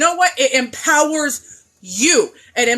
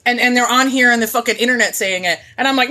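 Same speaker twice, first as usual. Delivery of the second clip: normal talking voice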